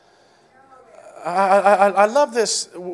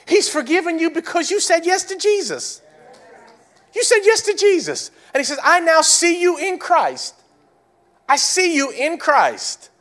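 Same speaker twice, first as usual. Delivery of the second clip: high preaching; 12 semitones higher, 4 dB louder than usual. Acted. Preaching at full force.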